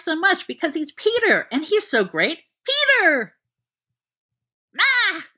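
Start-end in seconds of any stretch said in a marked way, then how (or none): 2.6-3.4 high, loud delighted yelp; excited puppy yelp. Acted. Dog Sirius shouts friend's name. 4.8-5.3 high, startled scream. Acted. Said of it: "Peter screams".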